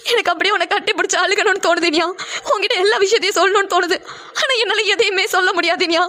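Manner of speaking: crying through the words; a sister weeping as she confides; tearful. Acted. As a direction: speak while holding back sobs. Pavi weeping while confiding.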